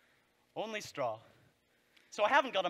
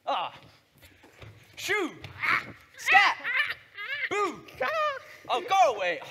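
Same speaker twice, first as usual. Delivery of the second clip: startling boo; 10 semitones higher than usual. Acted. Scarecrow trying to scare crows.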